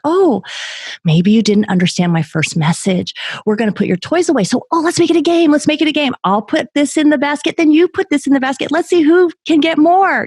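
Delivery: hammed-up eager mom trying to make cleanup fun; mom turning it into a game. Mom coaxing her kid to pick up toys, chirpy game voice. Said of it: "the mom's like".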